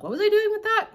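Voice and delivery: high, puzzled self-question; baffled high squeal. Retold or imitated. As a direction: squeak it out, totally lost. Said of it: "then I'm like".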